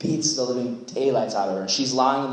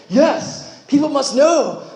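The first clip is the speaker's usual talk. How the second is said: high, loud, laughing cry; excited outburst; 12 semitones higher, 6 dB louder, slower than usual. Pretend. Leader imagines announcing an alien visit, loud and laughing. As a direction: burst out with it as if you can't hold it in.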